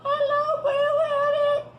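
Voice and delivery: very high, slow squeaky voice; too high. Acted. Fox faking the house voice, very high and slow. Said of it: "Now that's too high for my house".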